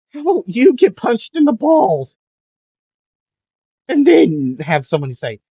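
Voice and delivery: high, loud cheeky voice; high, loud mocking comeback; mocking retort. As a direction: sass him right back. Midoriya mocking Aizawa.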